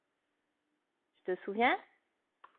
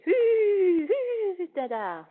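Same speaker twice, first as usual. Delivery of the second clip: high whooping giddy-up call; horse rider's call; 11 semitones higher, 8 dB louder than usual. Acted. Teacher plays Mademoiselle U spurring her horse. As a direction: urge the horse on with a high cry. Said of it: "Mademoiselle U sur son cheval".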